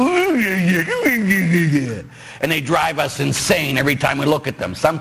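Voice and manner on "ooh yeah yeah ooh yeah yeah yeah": strained ooh-yeah through clenched frustration; mock exasperation at annoying people; exasperated groan. Priest mimicking people who drive us insane, exasperated. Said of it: "look at someone and go".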